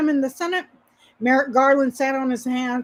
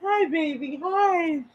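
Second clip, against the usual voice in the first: Mitch McConnell impression; 4 semitones higher, 3 dB quieter, slower than usual. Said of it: "Imitate Mitch McConnell".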